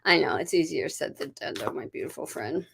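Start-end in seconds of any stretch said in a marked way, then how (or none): 1.5-2.7 whispered, softer aside; tender whispered aside. Real, not acted. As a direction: breathe it out gently to the viewer. Soft aside to viewer.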